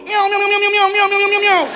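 high, loud string of overlapping miaows; frantic high-pitched kitten chorus; mewing kittens. Retold or imitated. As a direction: mimic a whole box of kittens mewing. Imitates a box of kittens.

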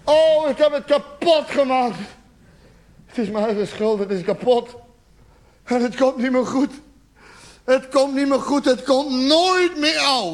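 hammed-up despair, swelling at the end; despairing self-blame. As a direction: wail as if all is lost.

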